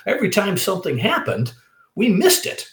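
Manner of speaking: high-pitched mock exasperation; exasperated protest. Telling Beaver they missed everything, exasperated. Retold protest to friend on phone.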